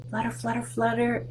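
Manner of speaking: soft, partly whispered flutters. Pretend. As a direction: breathe it out like wings. Butterfly sound again.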